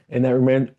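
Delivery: usual speaking voice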